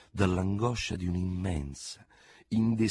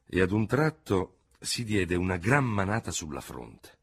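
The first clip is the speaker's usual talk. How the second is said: plain reading voice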